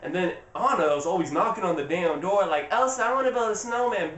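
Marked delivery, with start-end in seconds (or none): 2.7-4.1 higher-pitched imitation of a pestering little sister; mock pleading kid. Anna knocking on her sister's door, calling in a high mock-pleading voice. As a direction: call through the door like an eager kid.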